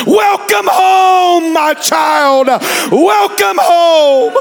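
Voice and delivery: slow soaring shouted welcome; impassioned shout. Real, not acted. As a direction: belt it out like a father calling. Same words shouted at climax.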